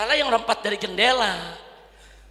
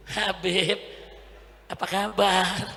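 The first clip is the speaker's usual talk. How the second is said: mock effeminate greeting; slower than usual. Preacher mimicking a man greeting the Habib, mock effeminate. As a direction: imitate a man greeting in a camp way. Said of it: "Ngomongnya begitu".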